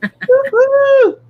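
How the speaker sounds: high excited whoop; cheering. Real, not acted. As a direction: whoop with joy. Cheering the vintage theme.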